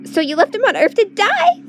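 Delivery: shrill horrified yell; screaming. A narrator's reading. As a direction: scream it at him in outrage. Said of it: "screams Sif".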